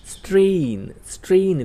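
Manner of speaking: સ્ટ્રેઇન said high and loud as English strain; teacher calling out સ્ટ્રેઇન for students to echo; emphatic demo. Shown as a demonstration. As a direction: call out સ્ટ્રેઇન for the class to repeat. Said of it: "બોલો".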